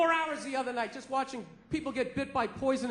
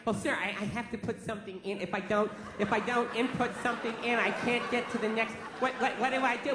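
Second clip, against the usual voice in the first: freaked out cashier; 4 semitones lower than usual. Retold or imitated. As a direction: play the panicking clerk lightly. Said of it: "all freaked out".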